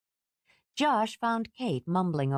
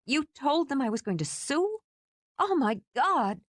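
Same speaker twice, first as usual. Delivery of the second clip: shocked; 10 semitones higher than usual. Acted. Shocked at legal action.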